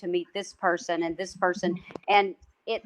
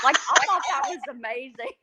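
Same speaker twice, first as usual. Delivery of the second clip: higher, laughing delight; real amused laughter; 5 semitones higher than usual. Real, not acted. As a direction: tell it through a laugh. Delighted laugh over the story.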